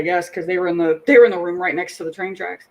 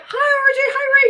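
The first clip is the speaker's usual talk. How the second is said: very high, sing-song greeting; gushing relatives; faster than usual. Retold or imitated. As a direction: coo a greeting like doting aunts. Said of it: "they're all like".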